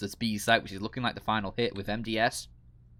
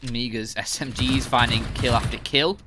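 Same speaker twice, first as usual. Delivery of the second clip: ordinary talk, nothing marked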